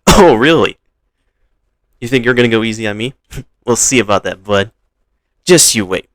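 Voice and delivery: playful challenge. Acted. Ash answering Pikachu's taunt, loud mock challenge. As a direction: throw the challenge back, loud and quick. Acted friendly challenge between rivals.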